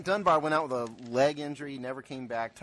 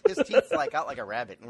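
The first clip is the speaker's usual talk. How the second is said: laughing; 2 semitones higher than usual. Real, not acted. Laughing while describing the teeth.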